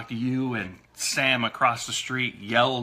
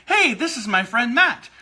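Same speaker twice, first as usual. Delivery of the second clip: bright introduction; 9 semitones higher, 5 dB louder than usual. Retold or imitated. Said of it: "I'm like".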